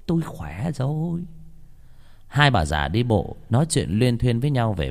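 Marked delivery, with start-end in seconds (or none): 0.1-1.2 put-on higher, softer, slow old woman reply; lightly voiced grandmother saying she's fine; old woman answering.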